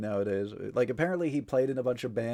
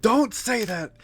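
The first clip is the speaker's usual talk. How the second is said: protesting yell; 10 semitones higher, 7 dB louder, slower than usual. Real, not acted. Laughing, protesting at chat.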